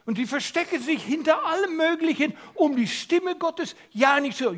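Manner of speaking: forceful preaching. Real, not acted. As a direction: drive the warning home from the pulpit. (kraftvolle Predigt)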